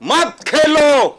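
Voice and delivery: loud, high, drawn-out repeated command; angry shouting. Real, not acted. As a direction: shout the other speaker down in real anger. Shouts over the host, don't play. (गुस्से में चिल्लाकर टोकना)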